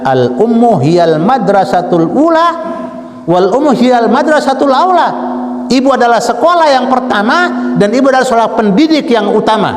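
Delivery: high, ringing preacher's proclamation; khotbah berapi-api. Real, not acted. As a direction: proclaim it to the congregation, high and forceful. (ceramah lantang bernada tinggi)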